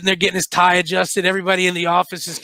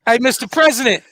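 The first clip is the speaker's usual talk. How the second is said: raised, slow mock call to the president, loud final pop; mock Abe stunt; 5 semitones higher, 5 dB louder than usual. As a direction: call out mockingly, then punch "Bop". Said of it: "pull that Abe".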